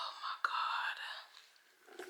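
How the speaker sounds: drained, breathy whisper; exhausted whisper. Real, not acted. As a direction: barely voice it, worn out. Exhausted after long hard task.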